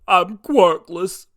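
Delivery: slow, loud tearful confession; a tearful admission, dragged out; teary villain. Acted. Villain looking up at All Might, slow tearful confession. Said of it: "The villain, teary-eyed".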